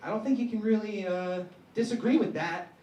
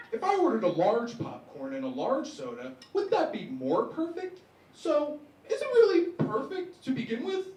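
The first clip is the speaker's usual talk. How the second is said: pedantic challenge; 7 semitones higher, 4 dB louder than usual. Retold or imitated. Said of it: "And he was like".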